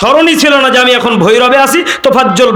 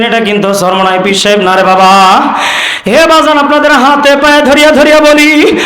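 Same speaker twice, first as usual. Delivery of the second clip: preacher impression; 2 semitones higher than usual. Shown as a demonstration. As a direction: plead with the crowd in a melodic waz chant. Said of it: "কণ্ঠটা কার?".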